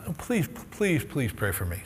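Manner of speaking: a hushed retold plea; desperate plea. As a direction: beg her quietly to pray. Desperate plea to praying lady.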